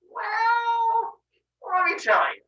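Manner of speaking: high, drawn-out hesitant opener; coy reveal. Acted. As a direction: stall on a high, stretched note. Mother Earth coyly begins revelation.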